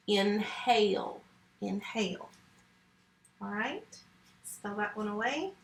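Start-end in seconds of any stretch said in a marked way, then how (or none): 0.1-1.2 drawn out slowly for the class; slow model read. Shown as a demonstration. Teacher reading inhale aloud to students, slow and clear. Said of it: "teacher models reading the word". 1.6-2.3 inhale said again, softer and quick; softer repeat. Shown as a demonstration. Teacher echoing the word inhale to herself, softer. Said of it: "teacher repeats the word".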